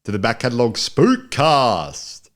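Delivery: slow, dramatic show opener; announcer intro. Acted. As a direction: announce it slowly like a spooky host.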